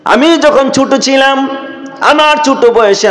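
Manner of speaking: high, chanted plea of a weeping man; tearful lament. Acted. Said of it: "এবার সে কেঁদে কেঁদে বলে".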